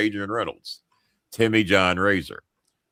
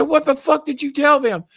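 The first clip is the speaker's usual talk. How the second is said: furious question. Retold or imitated. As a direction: demand an answer. Confronting Adrian, retold.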